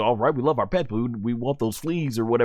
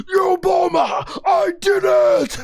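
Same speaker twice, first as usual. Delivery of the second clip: much higher, slow triumphant Rocky yell; Rocky impression; 4 dB louder, slower than usual. Vegeta crying out to Bulma after the win, Rocky-style shout. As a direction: shout it like a boxer who just won. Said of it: "he does his best Rocky impression".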